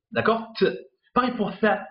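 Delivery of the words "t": "T" as a bare consonant, no vowel; only the start of the letter "T", cut off; blocked consonant. Shown as a demonstration. Teacher showing learners the start of the letter, "T" blocked without a vowel. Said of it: "on va lire seulement le début de la lettre".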